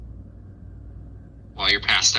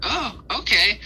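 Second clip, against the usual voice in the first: pixie; 11 semitones higher than usual. Acted. Pixie Jeremy delighted.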